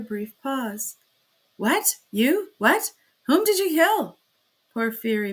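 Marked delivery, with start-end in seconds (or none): 1.6-4.2 much higher and louder, stunned questions; disbelieving, rising sharply; bewildered. A narrator's reading. Magistrate questioning the kneeling prisoner, bewildered. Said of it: "obviously bewildered".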